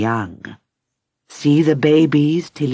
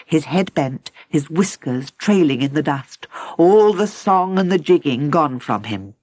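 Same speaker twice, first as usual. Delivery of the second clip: ordinary delivery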